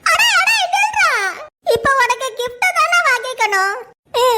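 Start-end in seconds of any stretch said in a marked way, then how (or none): 0.0-1.1 sudden high yell to halt; calling out. Acted. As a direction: shout after him to wait. Calling the boy to stop.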